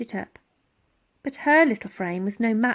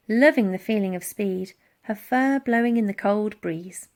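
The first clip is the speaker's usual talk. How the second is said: ordinary delivery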